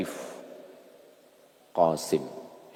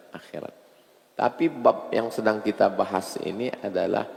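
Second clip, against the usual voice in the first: normal talking voice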